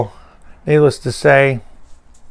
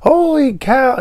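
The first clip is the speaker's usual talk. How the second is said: very high mock cry, moderate volume; imitated screaming; 13 semitones higher, slower than usual. People freaking out round the revived patient, high mock scream. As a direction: exclaim high, imitating panicked onlookers. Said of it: "and they're screaming".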